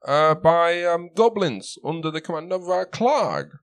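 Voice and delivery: high put-on accent that is fading; Sildar's accent, slipping. Sildar recounting the goblin ambush, high accent slipping. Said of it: "my bloody accent's going".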